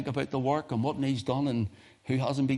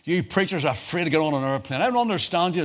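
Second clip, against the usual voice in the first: a mildly hammed-up exasperated pastor; pastor's exasperated complaint; 7 semitones higher, 6 dB louder than usual. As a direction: imitate the old pastor scolding his young preachers. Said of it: "used to say".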